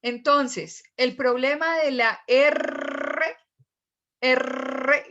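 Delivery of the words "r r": "R" with a long rolled trill; tongue-tip trilled R. Shown as a demonstration. Teacher models the problem sound, rolled R. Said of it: "tiene que estar en la punta de la lengua".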